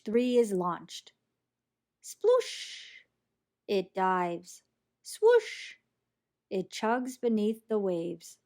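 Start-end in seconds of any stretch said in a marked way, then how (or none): 2.0-3.0 splash sound. A narrator's reading. Splash sound effect in story. 5.0-5.8 whoosh sound. A narrator's reading. Whoosh sound effect in story.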